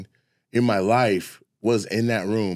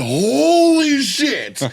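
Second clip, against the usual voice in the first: slow, higher, louder amazed exclamation; stunned; 12 semitones higher, 5 dB louder, much slower than usual. Retold or imitated. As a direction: drag it out in disbelief. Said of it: "I said".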